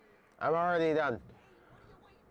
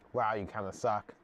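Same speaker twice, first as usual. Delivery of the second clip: ordinary delivery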